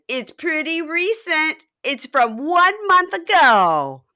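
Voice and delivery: high, loud, slow gloating; acted taunt. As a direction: stretch every word out to wound him. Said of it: "And she goes".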